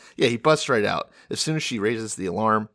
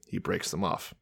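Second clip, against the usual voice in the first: normal talking voice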